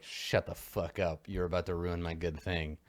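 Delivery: low-key, softly spoken warning; pointed warning. Acted. Dealer silencing a friend about to ruin the deal, pointed quiet warning. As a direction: warn them pointedly under the voice, soft. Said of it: "she looks at you pointedly".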